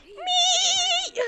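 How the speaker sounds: shrill high-pitched yell; high shout. Real, not acted. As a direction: shriek it out. Yelling in game.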